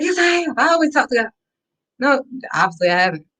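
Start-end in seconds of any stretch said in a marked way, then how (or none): 0.0-1.3 high, indignant protest; defensive retort. Retold or imitated. Insisting to friends she does talk to God, high and indignant. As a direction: protest it like you're offended. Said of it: "I'm like".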